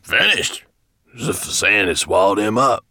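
loud dwarf voice, acting amazed; feigned bafflement. A narrator's reading. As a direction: play innocent to your master. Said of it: "as if baffled".

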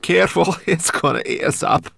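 put-on high, loud, frightened girl; panicked girl. A narrator's reading. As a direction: cry out in fear. Terrified girl.

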